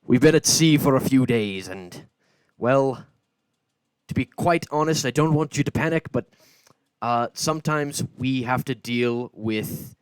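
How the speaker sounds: a booming ship captain addressing the passengers; loud captain. Acted. Captain warning the civilians about invasions, booming. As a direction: project the warning over the crowd. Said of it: "he does actually talk pretty loud".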